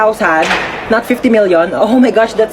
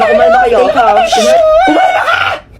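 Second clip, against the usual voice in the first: much higher, louder shout to calm down; frantic shout; 4 dB louder, much slower than usual. Acted. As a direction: yell for calm while panicking. (pasigaw na utos na kumalma)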